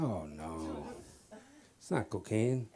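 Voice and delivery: low, soft mock reassurance; smooth denial. Husband lying to wife about cocaine, low smooth denial. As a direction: lie gently, dropping the voice right down.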